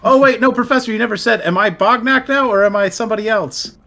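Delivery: excited. Real, not acted. Excited question to GM.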